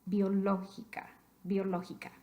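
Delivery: "Biológica" stressed on LÓ both times. Shown as a demonstration. Teacher confirming the written accent, biológica stressed on LÓ. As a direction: hit LÓ in each "biológica". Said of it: "necesitamos un acento escrito".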